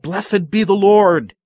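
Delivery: high, loud joyful cry of praise. Retold or imitated. The church at Obed's birth calls out, loud and joyful. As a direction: lift it up like the rejoicing church. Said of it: "cried out".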